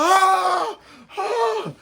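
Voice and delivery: very high yelps, half laughing; laughing while reliving the pain; pained yelling. Retold or imitated. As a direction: yelp through a laugh at the memory. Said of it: "I was yelling".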